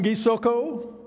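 ordinary delivery

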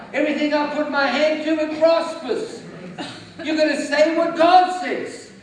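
loud, rising declarations of faith; preaching at full force. Acted. Preacher declaring to the congregation, loud and bold. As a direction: proclaim it like a victory.

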